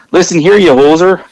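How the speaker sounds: bad Canadian accent, hammed up. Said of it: "worst Canadian accents of all time".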